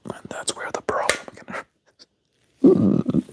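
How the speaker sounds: slow, trailing whisper; whispered aside. Pretend. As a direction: drop into a hushed confidential whisper.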